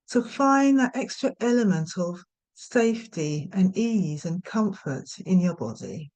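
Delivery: unhurried meditation guidance with long pauses; guided meditation. Real, not acted. Yoga nidra teacher settling listeners for rest, guided meditation. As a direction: gently talk them into rest, leaving space after each instruction.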